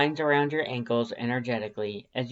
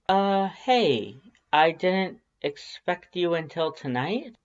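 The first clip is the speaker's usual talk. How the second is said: surprised; 6 semitones higher, 3 dB louder, slower than usual. Acted. Caught in pajamas.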